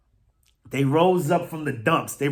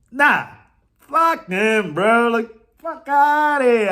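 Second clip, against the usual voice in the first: louder and higher, dragging fuck outta here; loud dismissive rant; 7 semitones higher, 6 dB louder, much slower than usual. Real, not acted. Eagles fan dismissing the Giants, loud contemptuous outburst. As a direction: spit it out with contempt.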